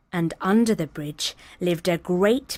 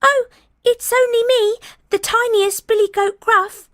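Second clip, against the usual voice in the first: much higher, louder small-goat voice; tiny goat; 6 dB louder than usual. Acted. Tiniest goat answering the troll, high and small.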